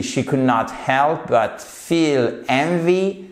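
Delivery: slow, careful English; slow model phrase. Shown as a demonstration. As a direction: read slowly for pupils to repeat. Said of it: "ne pouvait pas s'empêcher de ressentir quoi?". (lecture lente pour que les élèves répètent)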